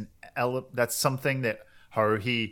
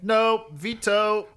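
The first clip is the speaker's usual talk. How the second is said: slow, higher mock veto; joking refusal; 9 semitones higher, 4 dB louder, much slower than usual. Kyon jokingly turning Koizumi down, slow and higher. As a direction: stretch it out like a playful no. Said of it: "joking, like".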